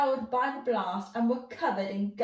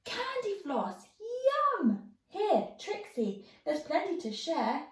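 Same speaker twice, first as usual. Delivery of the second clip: delighted cry; 9 semitones higher than usual. Acted. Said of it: "then cried".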